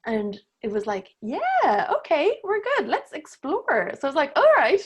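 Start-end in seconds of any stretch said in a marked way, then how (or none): 1.2-3.9 put-on high-pitched kid ready to go; eager child. Inner child now feels safe enough to explore, eager and high. 4.3-4.8 loud, high retold 'all right'; upbeat agreement. Woman recounts agreeing to explore with the child, upbeat.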